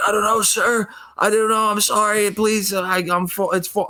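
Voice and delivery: high-pitched, flustered mock apology; panicked pleading. Helper apologizing to the feared security guard, acted-out high panic.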